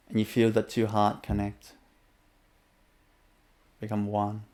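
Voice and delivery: unhurried inner-journey guidance; guided meditation. Acted. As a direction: guide the listener gently inward.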